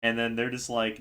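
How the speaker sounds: usual speaking voice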